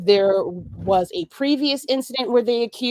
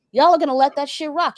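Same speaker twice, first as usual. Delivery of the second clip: ordinary talk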